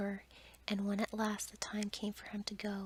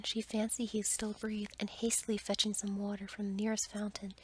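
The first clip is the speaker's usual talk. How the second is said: usual speaking voice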